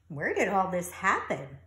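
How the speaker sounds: ordinary delivery, nothing marked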